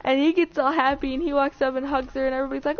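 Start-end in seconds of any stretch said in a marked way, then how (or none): none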